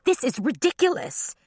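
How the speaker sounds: high, shrill protest. A narrator's reading. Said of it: "Ava shrilled".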